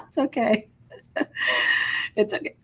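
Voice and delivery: high laugh breaking into tears; laugh, then say it through tears; a real teary laugh; crying laugh. Real, not acted.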